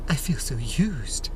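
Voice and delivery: slow, wounded whisper; hurt whisper. Acted. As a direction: breathe it like a used lover. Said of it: "And the trees are like".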